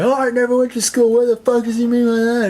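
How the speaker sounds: high, put-on voice of an offended listener; mock offended person. Mimicking a touchy listener who feels insulted, high and indignant. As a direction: squeak it out like someone taking offense. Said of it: "mess with people like".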